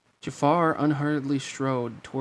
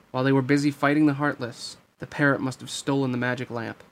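usual speaking voice

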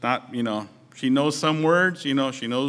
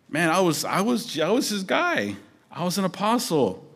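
higher-pitched mock bragging; boastful; 4 semitones higher than usual. Imagined Peter flaunting his position to the flock, boastful. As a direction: play at pulling rank, pitch lifted.

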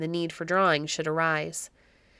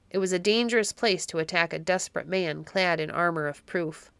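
usual speaking voice

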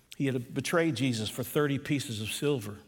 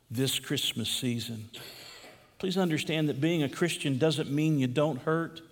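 ordinary delivery

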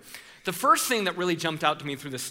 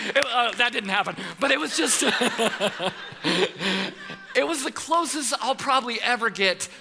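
laughing through it; 5 semitones higher than usual. Real, not acted. Laughing at own exaggeration.

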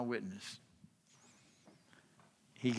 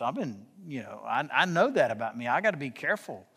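ordinary delivery

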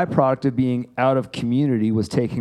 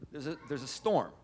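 storm sound effect; 3 semitones higher, 12 dB quieter than usual. Pretend. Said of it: "I'm gonna help Sean here".

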